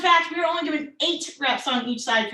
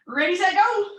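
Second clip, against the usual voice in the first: called-out start; 6 semitones higher, 5 dB louder than usual. Real, not acted. Coach starts the set.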